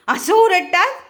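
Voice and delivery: high, loud mock-scolding question; chiding the dog. Real, not acted. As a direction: chide a pet for making noise.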